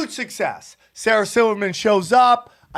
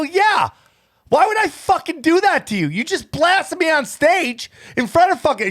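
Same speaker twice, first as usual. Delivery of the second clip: retold indignant; 8 semitones higher than usual. Retold argument with Sarah.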